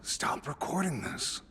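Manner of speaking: hushed unvoiced aside; whisper. Real, not acted. Player whispering to the group, hushed aside. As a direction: whisper it so the table can't hear.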